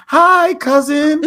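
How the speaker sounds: slow, high-pitched, gushing imitation; drawn-out high put-on greeting; Anna's greeting. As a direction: mimic a long-lost relative's sing-out hello. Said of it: "that's exactly how she said it".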